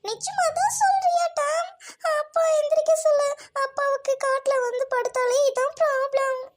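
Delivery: put-on high, tearful pleading child's voice; little girl crying over her father, pleading and high. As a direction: beg through tears, pitched high. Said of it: "Suji, don't cry".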